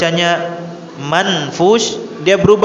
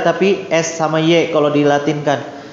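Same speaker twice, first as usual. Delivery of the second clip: ordinary talk, nothing marked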